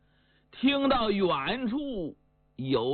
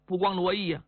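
ordinary delivery

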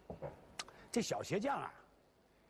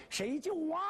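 calling for help; 11 semitones higher than usual. Acted. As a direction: shout up for rescue. Shoemaker calls from well.

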